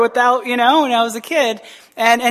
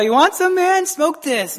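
tempting friend; 7 semitones higher than usual. Retold or imitated. Friends offering him a smoke.

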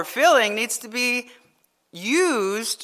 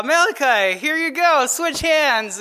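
raised, louder singled-out announcement; imitated gleeful daughter; 5 semitones higher, 4 dB louder than usual. Father imitating his daughter swapping hands with Malachi, lightly mock-gleeful. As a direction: play a child springing a trick.

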